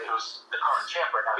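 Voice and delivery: ordinary delivery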